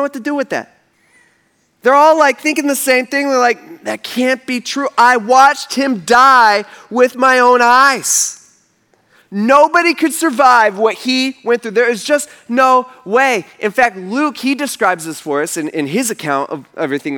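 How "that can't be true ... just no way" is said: slow, raised, emphatic protest; emphatic disbelief. Acted. Women rejecting the angel's news, emphatic disbelief. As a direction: stretch the words out in incredulity.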